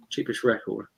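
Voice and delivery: normal talking voice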